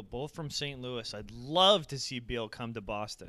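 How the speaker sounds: ordinary talk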